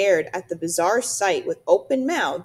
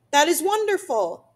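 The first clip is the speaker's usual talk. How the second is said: higher, louder, pleased exclamation; delighted; 8 semitones higher, 5 dB louder than usual. A narrator's reading. Robot answers the beaver's offer of help, delighted. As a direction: lift it up with delight.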